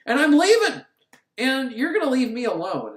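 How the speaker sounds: high, loud mock huff; imitating an angry wife; acted anger. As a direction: storm out in hammed-up indignation. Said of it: "She, she's angry".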